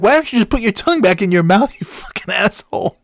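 high, loud, fast mock scolding; imitated irritated wife. Wife telling husband to put tongue back, irritated mock scolding. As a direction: snap like a fed-up wife. Acting out wife snapping at him.

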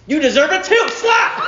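high, loud, rising to a peak; defiant outburst. Acted. Preacher plays son defying his dad, high defiant outburst.

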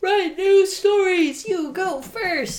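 high, slow goofy voice; silly high voice. Pretend. Teasing voice to co-host.

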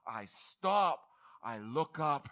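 ordinary talk, nothing marked

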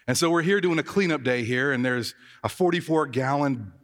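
ordinary talk

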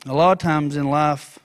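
low, soft, sincere sermon voice; reflective preaching. Real, not acted. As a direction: bring it down to a hushed, earnest reflection. Turns from storytelling to reflection.

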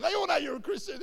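high, loud, rapid outburst; fast mock-alarmed exclamation; sudden exposure. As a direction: blurt it out as if everyone heard.

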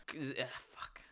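under-breath whisper; whispered curse. Real, not acted. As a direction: curse to yourself. Muttering to herself mid-turn.

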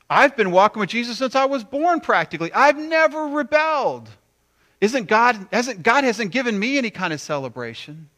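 high, loud, indignant boasting; self-righteous complaint. Acted. Church person resenting others' celebration, indignant and high. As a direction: protest your spotless record, pitched high.

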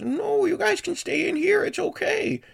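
put-on very high, loud old lady; high-pitched, pushy hostess; insistent old host. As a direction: squeal it out high, desperate to keep them in. Host voice blocks the doorway.

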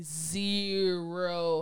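"zero" stretched out long; stress on zero. Real, not acted. Host stressing the lack of help given, "zero" drawn out. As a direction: draw out "zero" slowly for emphasis.

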